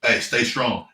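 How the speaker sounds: normal talking voice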